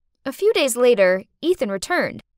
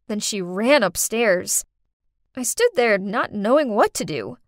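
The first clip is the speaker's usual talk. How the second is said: plain reading voice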